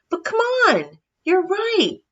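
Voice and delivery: high, bright and brisk; rally the animals with sudden cheer; cheerful Santa. Acted.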